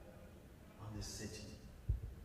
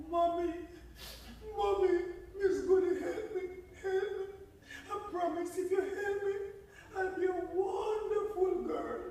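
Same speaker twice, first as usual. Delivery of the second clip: much higher, desperate begging; pleading. Acted. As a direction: beg mommy to save you. Head begs for help.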